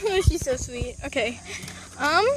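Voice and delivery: ordinary delivery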